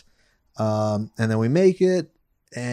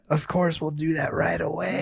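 higher, softer mock-obliging voice; feigned eagerness; 4 semitones higher, 6 dB quieter than usual. Son answering his mom's imposition, falsely obliging. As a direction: agree far too sweetly. Sarcastic eager reply to mom.

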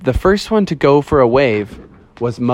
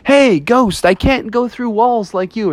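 high-pitched protest to the ghost; complaining bear; 9 semitones higher than usual. Acted. Bear who bumped the door calling to the ghost, high complaint.

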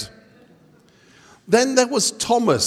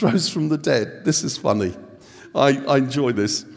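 ordinary talk, nothing marked

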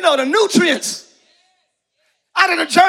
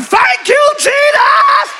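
high, loud shout of thanks; loud high praise shout; 9 semitones higher, 3 dB louder, much slower than usual. Shown as a demonstration. Preacher leading the church in a loud shout of praise. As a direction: lift it up and shout it. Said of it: "Let's all do it one more time".

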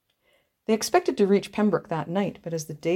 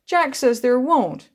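put-on higher, louder and slow, a small girl's whimpering complaint; tearful little girl; 7 semitones higher, 7 dB louder, slower than usual. A narrator's reading. Small sister tattling on her brother to Aunt Cyrilla, slow tearful child voice. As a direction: pout it out slowly.

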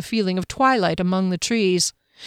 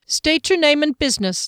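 stern guard; 8 semitones higher than usual. A narrator's reading. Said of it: "the guard said".

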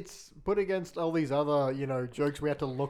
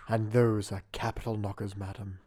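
sultry narrator, lay it on thick; sexy voice; 6 semitones lower than usual. Acted. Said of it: "give it to us in your sexy voice".

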